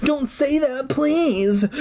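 very high, begging cry; pleading. A narrator's reading. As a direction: beg in a high, hammed-up wail. Character begging his friend to stop.

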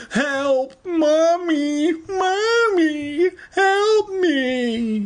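long, wailing falsetto squeals; hammed-up screaming. A narrator's reading. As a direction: wail for mommy at the top of your range. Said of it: "he screamed like no one ever has".

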